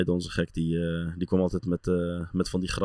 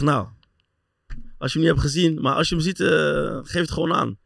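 imitating the physio; 7 semitones higher than usual. Physio answering the teammate, lightly mocked in a higher voice. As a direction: play the physio politely asking, pitched up. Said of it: "hij zegt".

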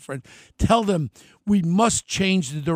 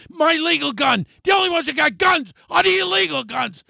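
angry shouting; 7 semitones higher than usual. Real, not acted. Host venting to listeners about gun laws, furious shout. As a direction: hammer each can't as the anger peaks. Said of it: "I'm so sorry for getting so, so angry".

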